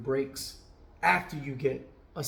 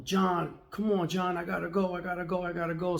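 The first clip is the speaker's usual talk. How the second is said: impatient customer; 5 semitones higher than usual. Retold or imitated. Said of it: "And he kept saying".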